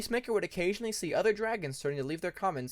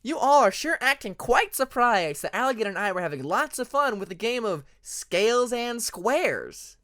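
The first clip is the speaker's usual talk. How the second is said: teasing; 7 semitones higher, 6 dB louder than usual. Acted. Hyena teasing innocently.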